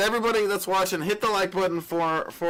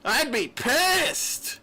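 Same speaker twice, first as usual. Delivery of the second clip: mock outrage; 8 semitones higher, much slower than usual. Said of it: "I'm kidding, guys".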